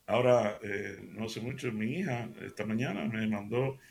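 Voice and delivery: usual speaking voice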